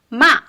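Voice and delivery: MA short, falling from high; fourth tone. Shown as a demonstration. Teacher teaching word for scold, "Ma" falling quickly. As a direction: drop "Ma" sharply.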